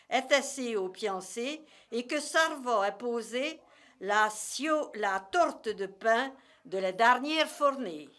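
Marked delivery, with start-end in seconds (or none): none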